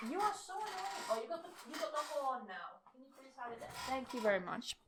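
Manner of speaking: soft, distracted aside; aside to dog. Real, not acted. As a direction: mutter to the dog off to the side. Talking to dog off-mic.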